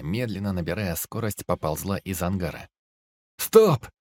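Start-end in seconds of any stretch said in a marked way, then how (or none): 3.4-3.9 very high, very loud yell. Acted. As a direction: scream it to stop the machine. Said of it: "заорал Мяу".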